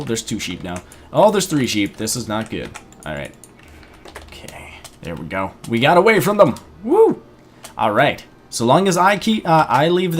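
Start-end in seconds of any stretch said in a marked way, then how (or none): 3.1-5.5 hushed sneaky whisper. Real, not acted. Sneaking away from sheep. 6.8-7.2 high triumphant woo; excited whoop. Real, not acted. Escaped the sheep.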